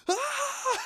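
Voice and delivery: high mock scream of panic; retold scream. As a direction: yelp like a scared kid. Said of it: "freaking out and screaming".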